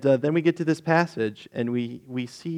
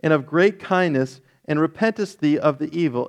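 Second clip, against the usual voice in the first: raised, earnest Bible reading voice; scripture reading; 3 semitones higher, 3 dB louder than usual. Acted. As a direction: read the passage aloud with weight. Reads Jonah chapter aloud.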